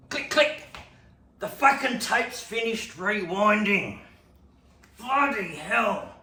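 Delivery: high loud annoyed shout; loud startled cursing; startled outburst. Retold or imitated. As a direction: jolt and swear loudly. Startled by tape ending.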